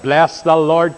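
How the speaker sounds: ordinary delivery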